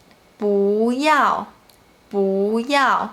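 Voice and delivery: "不" rising before falling "要"; second-tone bu. Shown as a demonstration. Teacher demonstrates the bu rule for learners, rising 不. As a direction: say "不要" slowly, "不" in second tone. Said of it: "it change into the second tone".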